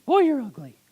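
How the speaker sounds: high-pitched parrot squawk; rude parrot. Acted. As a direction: blurt the insult in a parrot voice. Parrot repeats its insult.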